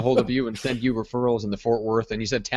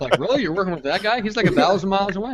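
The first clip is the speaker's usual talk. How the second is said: high, loud mock disbelief; a playful sneer, pitched high; mock incredulous; 10 semitones higher, 5 dB louder than usual. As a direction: scoff at them in a raised, incredulous voice. Mocking a client's choice of agent.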